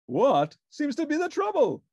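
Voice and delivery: grand, self-important sleuth; Sherlock Hemlock voice. A narrator's reading. Detective answering Ernie's call, pompous and grand.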